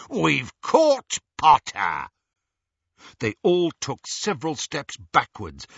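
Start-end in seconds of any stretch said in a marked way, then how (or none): 0.1-2.1 loud, slow, gloating announcement; triumphant villain. A narrator's reading. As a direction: proclaim the catch, drawing out each word. Greyback announces the catch in triumph.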